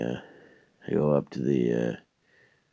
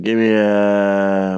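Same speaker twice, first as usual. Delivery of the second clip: put-on yokel voice; 6 semitones higher, 10 dB louder, much slower than usual. Ordering at McDonald's counter, slow loud bumpkin voice.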